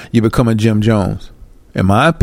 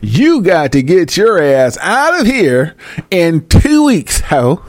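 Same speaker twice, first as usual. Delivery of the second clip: mock landlord; 7 semitones higher, slower than usual. Voicing the eviction letter.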